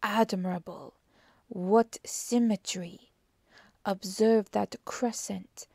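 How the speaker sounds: slow, savouring admiration in a detective's voice; Poirot. Acted. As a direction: relish each detail, measured then livelier. Poirot admires the flower beds.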